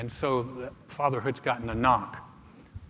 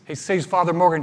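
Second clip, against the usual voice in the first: ordinary talk, nothing marked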